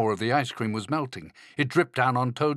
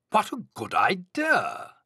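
high, delighted storybook voice; pleased agreement, pitched up; light up at the idea; delighted Toad; 7 semitones higher than usual. A narrator's reading. Toad answers Frog in story.

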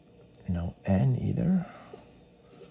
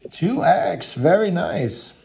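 excited; 9 semitones higher, 6 dB louder, slower than usual. Real, not acted. Excited over a lottery multiplier.

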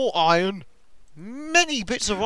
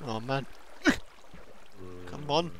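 usual speaking voice

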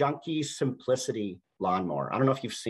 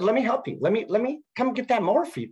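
higher-pitched, eager retold offer; 9 semitones higher, 4 dB louder than usual. Offering to fetch a frustrated man's mower, eagerly. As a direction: jump in keen to help.

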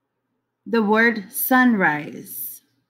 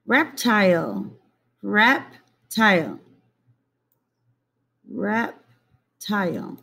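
slow syllables; slower than usual. Shown as a demonstration. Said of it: "slowing the word down".

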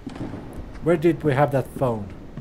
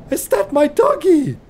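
surprised delight; 11 semitones higher, 5 dB louder than usual. Real, not acted. Spots his dog in game.

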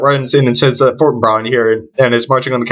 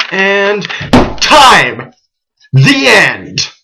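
loud, much higher victory yell; triumphant cheer; 6 semitones higher, 3 dB louder, much slower than usual. Real, not acted. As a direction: celebrate finishing the run. Speed run finished.

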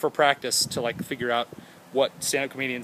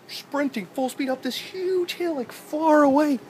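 high put-on voice; 13 semitones higher, slower than usual. Odd voice while narrating.

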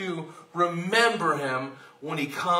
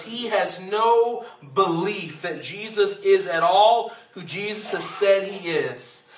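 usual speaking voice

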